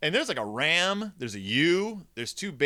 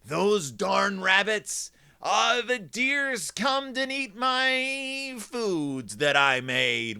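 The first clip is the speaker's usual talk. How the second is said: slow, drawn-out mock whining; complaining gardeners; 5 semitones higher, much slower than usual. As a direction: whine like an aggrieved gardener, stretching the words. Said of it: "people grow vegetables ... and they're like".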